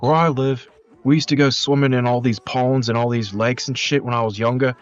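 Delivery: high-pitched mock complaint; imitated ranting local. Retells TikTok rant about Bindi Irwin.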